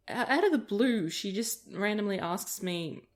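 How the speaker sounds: ordinary talk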